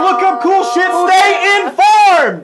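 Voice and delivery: high-pitched yelled list of parting advice; shouted sign-off. Pretend. Host giving listeners parting advice, high shouting. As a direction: holler each tip in a silly high voice.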